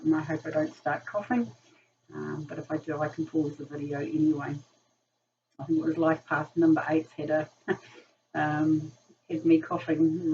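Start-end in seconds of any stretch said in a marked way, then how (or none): none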